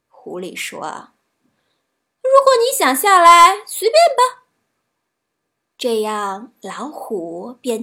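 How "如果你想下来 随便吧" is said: high, loud, quick fox; sly fox's voice. A narrator's reading. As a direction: toss out the invitation in a high voice. Fox invites tiger down. (狐狸高声随口答应)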